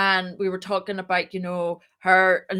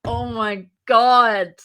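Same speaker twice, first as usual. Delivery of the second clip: a drawn-out, slowed, louder oh my God; stunned; 5 dB louder, much slower than usual. Retold or imitated. Co-founder sees her own face on the slide, stunned and slow. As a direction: let the words hang, mind blown. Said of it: "mind blown moment".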